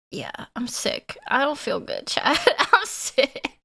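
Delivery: ordinary talk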